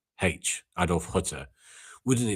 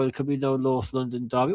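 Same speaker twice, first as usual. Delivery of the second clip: high, whiny mock fan; mock fretting fan; 6 semitones higher than usual. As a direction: parody their worry lightly. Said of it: "I see Arsenal fans saying".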